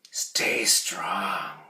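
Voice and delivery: whispered, no voice; whispered orator's line. Shown as a demonstration. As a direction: breathe it out in a hush. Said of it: "just changing the volume".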